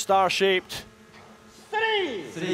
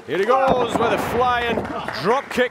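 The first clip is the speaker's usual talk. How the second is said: excited call; 6 semitones higher than usual. Real, not acted. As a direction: build to the big flying move. Commentator calls flying drop kick.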